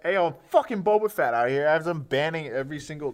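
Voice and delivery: high, loud impersonated rant; mock tirade. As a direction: shout it like a hammed-up boast. Acting out the catchphrase.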